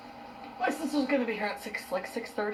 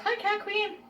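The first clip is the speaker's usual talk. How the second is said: excited greeting; 13 semitones higher, slower than usual. Real, not acted. Streamer greets a viewer excitedly.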